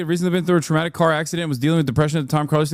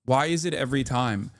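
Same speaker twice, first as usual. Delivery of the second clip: ordinary talk, nothing marked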